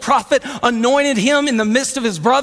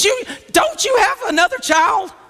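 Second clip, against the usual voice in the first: incredulous prophet; 6 semitones higher than usual. Acted. Prophet prompts the forgetful daddy.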